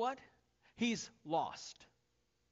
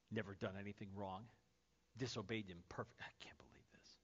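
muttered, hushed grumbling to himself; sulky whisper; 12 semitones lower, 13 dB quieter than usual. Retold or imitated. Older brother sulking outside the party, whispered grumble. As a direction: sulk under your breath, very soft. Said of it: "he's a party pooper".

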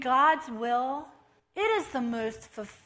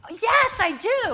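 cheerful exclaim; 9 semitones higher, 10 dB louder than usual. Retold or imitated. Retold cheerful reply.

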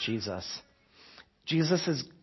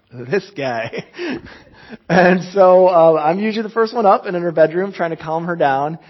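laughing; 5 semitones higher, 11 dB louder than usual. Real, not acted. Laughing telling story.

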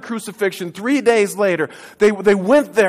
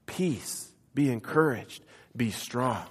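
soft, gentle blessing; a soft-spoken benediction; quiet blessing; 6 semitones lower, 10 dB quieter, slower than usual. Acted. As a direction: hush the room and bless them. Blessing after the angel's words.

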